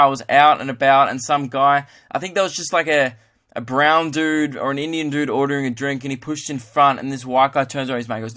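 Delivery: ordinary talk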